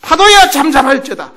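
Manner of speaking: high, forceful order; loud command. Acted. Jesus commands the waves to be still, loudly. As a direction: raise the voice to master the sea. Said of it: "예수님이 명령하시자".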